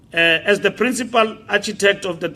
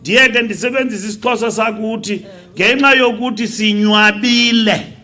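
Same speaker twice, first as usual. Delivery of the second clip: loud, raised political oratory building to a shout; impassioned rally speech; 6 semitones higher, 10 dB louder than usual. Real, not acted. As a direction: drive it home to the crowd, getting louder and higher.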